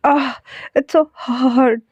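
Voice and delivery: slow, strained words through chest pain; pained groan. Acted. Dying man to caller, slow pained groan. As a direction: drag each word out as if it hurts.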